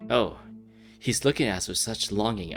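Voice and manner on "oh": ordinary talk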